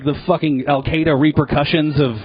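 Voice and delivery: ordinary talk, nothing marked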